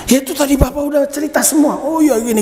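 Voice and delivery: quick, high retold reply; acted dialogue. Preacher voices the exchange after prayer, quick high acted reply. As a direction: lightly act out a hurried, high-pitched answer. Acting officer's astonished exchange.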